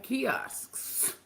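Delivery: kiosks with a drawn-out hissing ending; kiosks, every end consonant laboured; careful pronunciation. Real, not acted. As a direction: labour over the final cluster in kiosks. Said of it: "it's hard to say".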